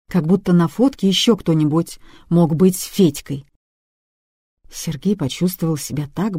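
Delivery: usual speaking voice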